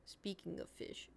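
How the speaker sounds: whispered aside. Real, not acted. After a gasp she whispers her aside to the viewers. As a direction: breathe it out like a secret.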